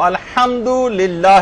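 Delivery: slow careful الحمدللہ with a throaty Arabic ح; correct Arabic recitation. Shown as a demonstration. Teacher showing students the correct الحمدللہ, slow and careful. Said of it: "جب آپ الحمد پڑھیں گی تو".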